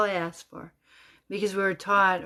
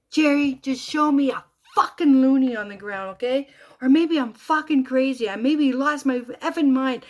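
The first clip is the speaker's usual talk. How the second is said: loud, higher, desperate demand; retold inner scream; 6 semitones higher, 7 dB louder than usual. Widow demanding a sign from Jeremy, screaming inwardly. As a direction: plead forcefully with raised voice. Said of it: "I scream in my head".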